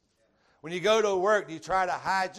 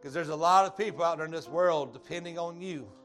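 louder inviting call over music; altar call; 4 dB louder than usual. Acted. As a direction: urge them forward to the altar.

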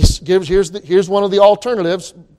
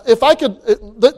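ordinary delivery

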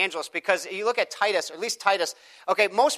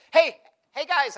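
calling out; 10 semitones higher, 4 dB louder, slower than usual. Retold or imitated. Calling out to teenagers.

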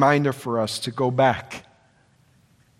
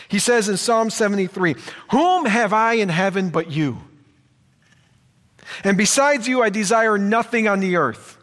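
fervent preaching; 5 semitones higher than usual. Acted. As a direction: drive the psalm home to the church. Preaching at full force.

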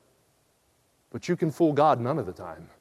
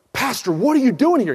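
higher and louder, startled exclamation; shocked; 8 semitones higher, 6 dB louder than usual. Retold or imitated. [name] seeing his old pastor walk into the bar, shocked. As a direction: blurt it out, caught off guard. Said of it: "And I looked up shocked".

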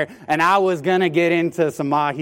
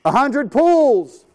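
high, slow shout of the number; yelled order; 11 semitones higher, 6 dB louder, much slower than usual. Retold or imitated. Captain yelling the depth to the men, slow shout. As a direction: bellow it across the deck. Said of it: "he yells".

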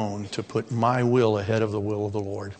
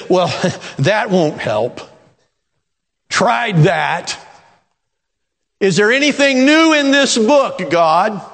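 mock weary complaint; 8 semitones higher, 6 dB louder, slower than usual.

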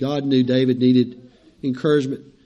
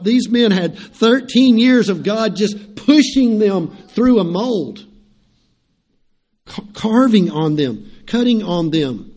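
raised, higher fervent preaching; 4 semitones higher, 4 dB louder than usual. Acted. Pastor telling the church of David's and Paul's suffering, fervent preaching. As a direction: plead it from the pulpit with mounting fervor.

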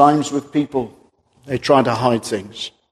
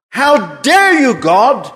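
angry defiance; 9 semitones higher, 4 dB louder, slower than usual. Acted. Cain defies God.

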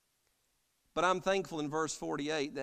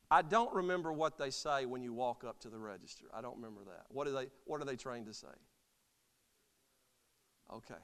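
usual speaking voice

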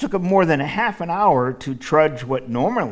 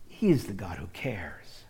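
whispered, tender and hushed; hushed tender reassurance; 5 semitones lower, 8 dB quieter than usual. Acted. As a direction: breathe it out like a secret. Repeated line, second whispered.

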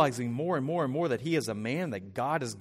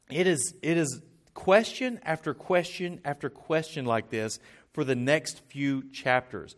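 ordinary delivery

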